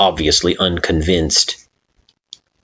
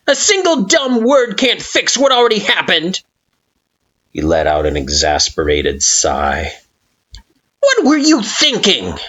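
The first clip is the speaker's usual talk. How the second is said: exasperated scolding; 14 semitones higher than usual. Acted. Flowey exasperated.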